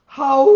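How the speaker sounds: loud imitated wolf howl. Reading a picture book aloud, the wolves howl loudly. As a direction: throw your head back and howl like a wolf. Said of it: "the wolves start to go".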